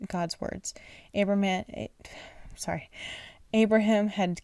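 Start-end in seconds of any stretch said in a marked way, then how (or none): none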